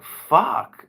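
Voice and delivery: sudden high, loud curse of frustration; hammed-up annoyed outburst; exasperated curse. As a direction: blurt it out like a song won't leave your head. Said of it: "And he's like".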